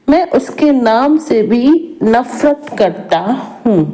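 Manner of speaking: ordinary talk, nothing marked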